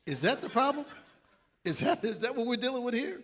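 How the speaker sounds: lightly voiced coach, amazed at the answer; incredulous coach. Coach reacting to player's plans.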